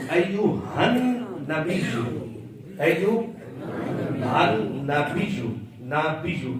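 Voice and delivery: slow "ایو ہن نبیو", held han and pressed biyy; deliberate piece-by-piece "ایو ہن نبیو"; correct pronunciation demo. Shown as a demonstration. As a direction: stretch "نبیو" with doubled ya. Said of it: "با کو یا کے ساتھ ملانا ہے".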